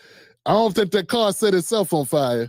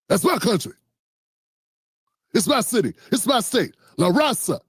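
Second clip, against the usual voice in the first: Debo voice. Retold or imitated. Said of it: "They sound like Debo".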